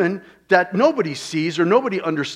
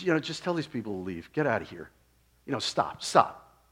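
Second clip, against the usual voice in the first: softer, quick, quiet instruction; humble and meek; 5 semitones lower, 5 dB quieter than usual. Retold or imitated. Jesus quietly sending the mourners out, meek and soft. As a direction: say it gently, almost to yourself. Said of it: "humbly and meekly".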